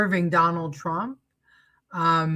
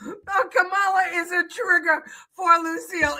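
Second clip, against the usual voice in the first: laughing; 12 semitones higher than usual. Real, not acted. Still laughing about Lucille.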